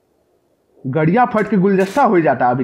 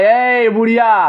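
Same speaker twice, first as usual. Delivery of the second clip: mock call; 7 semitones higher, 4 dB louder, slower than usual. Real, not acted. Taunting the game granny.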